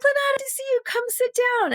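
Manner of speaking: very high-pitched imitation of a welcome; mock effusive, high-voiced hello; gushing greeting. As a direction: squeal a welcome like a delighted agent. Imitates agents greeting her on arrival.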